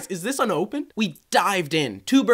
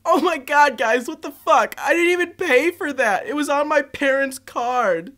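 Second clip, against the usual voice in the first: a hint of a whiny spoiled girl, higher; 6 semitones higher, 4 dB louder, slower than usual. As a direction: whine it like a spoiled brat. Girl whines about her food.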